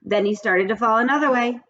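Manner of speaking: usual speaking voice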